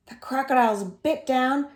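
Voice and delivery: usual speaking voice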